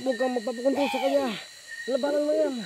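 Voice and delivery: urgent encouragement against possession; urgent plea. Real, not acted. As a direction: urge them to fight it off. Urging to resist possession.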